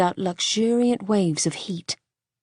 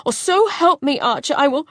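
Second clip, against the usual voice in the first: much higher, louder, choking anger; fierce; 8 semitones higher, 5 dB louder than usual. Acted. Wife threatening her husband, fierce and tearful. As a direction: threaten through tears. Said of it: "she cut in fiercely".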